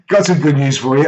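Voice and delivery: upbeat announcement. Retold or imitated. Husband phones Lynn with news, upbeat. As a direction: retell it as a bright phone announcement.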